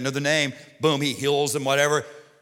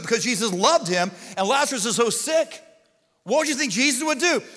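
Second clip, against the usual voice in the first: ordinary talk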